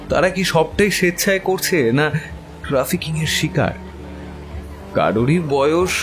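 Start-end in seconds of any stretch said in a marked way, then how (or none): none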